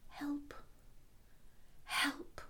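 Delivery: far-off muffled cry for help; a call from outside the door; faint distant cry. Acted.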